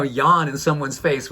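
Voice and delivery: normal talking voice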